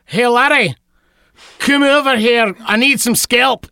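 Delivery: lightly put-on Scottish accent; a hint of a Scotsman calling a lad over; playful mock Scottish accent; Scottish accent on request. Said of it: "With an accent?".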